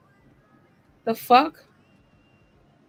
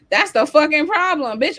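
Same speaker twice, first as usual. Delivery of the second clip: louder, higher, hitting problem hard; loud exasperated rant; 5 semitones higher, 6 dB louder than usual. Real, not acted. Host ranting at mothers in her chat, loud and exasperated. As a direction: let the frustration burst out on problem.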